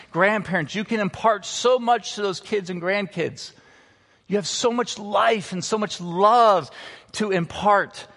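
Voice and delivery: ordinary delivery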